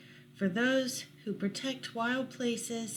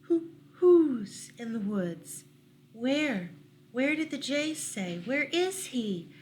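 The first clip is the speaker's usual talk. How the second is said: louder, slow fussing owl questions; anxious owl; 2 semitones higher, 4 dB louder than usual. Acted. Owl of many questions demanding news of the stranger, loud hooting. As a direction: hoot out each question. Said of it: "asked the owl of many questions".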